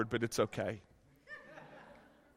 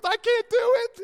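very high imitation of a helplessly amused wife; laughing wife; 4 dB louder than usual. Wife too tickled to help husband shower, helpless. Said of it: "And she's laughing".

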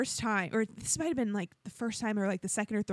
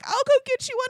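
high, louder eager apology; apologetic; 7 dB louder, faster than usual. Retold or imitated. Guilty husband offering to replace the treat, apologetic. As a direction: imitate a guilty offer to make amends, pitched high. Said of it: "he felt so bad".

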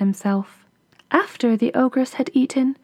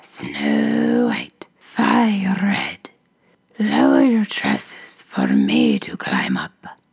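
hoarse whispering ogress call; much slower than usual. A narrator's reading. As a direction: rasp it out like a menacing ogress. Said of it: "she called again".

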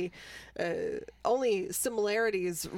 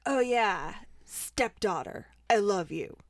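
hammed-up cheesy hero declaration; lightly voiced cheesy action hero; parodying a corny movie hero; mock sappy hero; 5 dB louder than usual. Mocking Jonas's sappy hero line.

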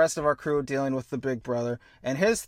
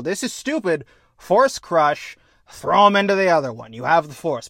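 loud, raised-pitch indignation; mock-outraged fan rant; acted exasperation; 3 semitones higher, 6 dB louder than usual. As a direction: argue as if it's painfully obvious. Acts out own exasperated objection.

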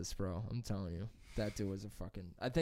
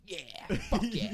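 mock cheer; 8 semitones higher, much slower than usual.

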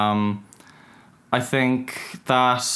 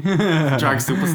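mock grand echo; 5 semitones higher, 3 dB louder, slower than usual. Echoing friend's phrase playfully.

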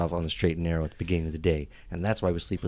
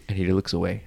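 ordinary talk, nothing marked

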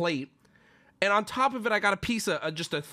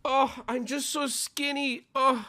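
higher, slow, smug whiny mockery; mocking skinny guy; 6 semitones higher than usual. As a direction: sneer like a boastful skinny guy. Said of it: "get a load of this guy".

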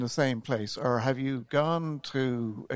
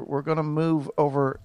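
ordinary talk, nothing marked

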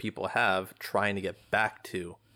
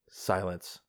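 ordinary delivery

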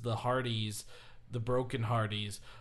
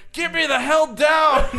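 put-on high, loud, slow old-man plea; a stranded old man begging to come down; hammed-up old wrestler stuck up high; old man yelling; 15 dB louder than usual. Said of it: "he's just this old man going".

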